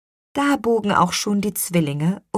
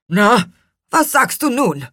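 higher-pitched grumpy challenge; mildly grim character voice; 6 semitones higher, 6 dB louder than usual. A narrator's reading. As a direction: ask it grimly, pitched up. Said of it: "fragte Gurkenhut grimmig".

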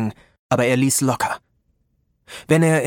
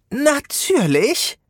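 much higher, slow squeak; squeaky; 12 semitones higher, much slower than usual. A narrator's reading. Little sorceress squeaks in disbelief at Rob. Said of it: "Ihre Stimme quiekte".

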